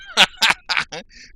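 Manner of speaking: loud, high burst of laughter; big high laugh; real laugh. Real, not acted. Laughs about working on this.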